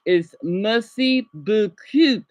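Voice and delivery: merci beaucoup drawn out with a rise; slow French demo. Shown as a demonstration. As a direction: stretch out merci beaucoup for learners. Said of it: "if you're gonna say thank you very much".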